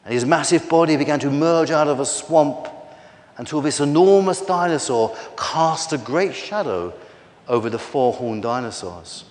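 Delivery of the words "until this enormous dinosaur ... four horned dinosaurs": ordinary delivery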